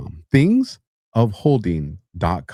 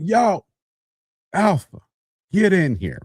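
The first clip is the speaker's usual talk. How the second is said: higher-pitched summons across the office; boss calling out; 9 semitones higher, slower than usual. Acted. Boss summons an employee from the office door, calling out. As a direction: call a worker over in short bursts.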